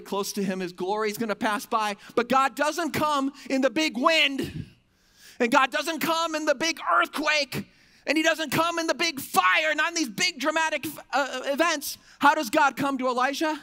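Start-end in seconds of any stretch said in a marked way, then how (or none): 2.1-13.6 high, loud build toward a question; booming sermon storytelling, pitched high; dramatic preaching. Real, not acted. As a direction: proclaim each big event with force. Preacher dramatizes big wind, earthquake, fire.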